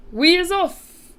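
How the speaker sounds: high, loud, drawn-out shout; rallying cry. A narrator's reading. BFG setting off from the cave, triumphant cry. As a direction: giant announcing departure. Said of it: "cried the B F G".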